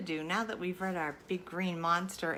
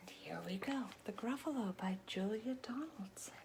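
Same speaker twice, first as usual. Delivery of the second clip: soft unvoiced whisper; 11 dB quieter than usual. Reader whispering to the kids as the monster is done. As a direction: wind down in a whisper.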